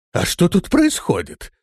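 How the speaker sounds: dull, uncomprehending question; dim-witted puzzlement. A narrator's reading. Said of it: "тупо повторил вопрос Высокий".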